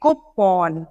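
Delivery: कूपन said koo-pon; correct coupon. Shown as a demonstration. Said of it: "कूपन नहीं बोलोगे".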